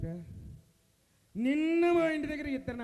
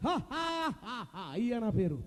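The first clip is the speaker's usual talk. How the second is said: Rajinikanth impression; slower than usual. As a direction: laugh in separate has, then announce yourself. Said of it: "నీ style మాత్రం నచ్చినది".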